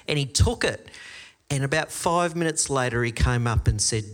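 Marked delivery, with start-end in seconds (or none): none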